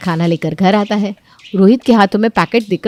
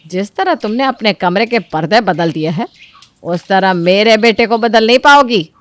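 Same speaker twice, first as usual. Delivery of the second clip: loud, stern mother-in-law; threatening warning; 4 dB louder than usual. Acted. Mother-in-law warning Chanda off her son, stern. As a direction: warn her off coldly.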